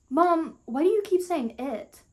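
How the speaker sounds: high-pitched demand to Mom; acted exasperated daughter. Daughter challenging Mom's choice of word, acted exasperation.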